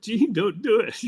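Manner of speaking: high, loud mock holler; imitated yell. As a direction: yell it upward like a desperate warning. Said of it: "Dave was yelling at the scoreboard".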